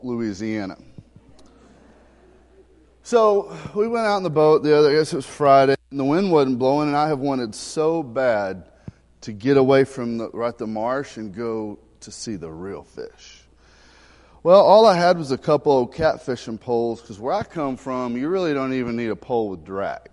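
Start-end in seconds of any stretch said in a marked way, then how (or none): none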